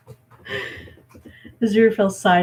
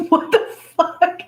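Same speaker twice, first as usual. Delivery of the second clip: shocked; 12 semitones higher, 6 dB louder, slower than usual. Real, not acted. Shocked at the video.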